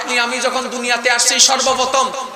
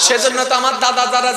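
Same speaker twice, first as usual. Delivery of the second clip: ordinary talk, nothing marked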